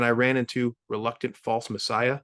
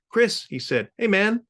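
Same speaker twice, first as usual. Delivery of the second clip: friendly greeting; 10 semitones higher, 4 dB louder than usual. Acted. Cyclonic greets narrator.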